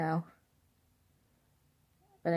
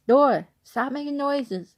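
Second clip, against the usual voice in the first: scolding the door; 8 semitones higher, 5 dB louder, slower than usual. Real, not acted. Scared by creaking door.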